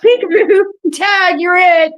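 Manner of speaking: gleeful teasing call; playful shout. Real, not acted. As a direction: shout it back like a game. Playful reply to peekaboo.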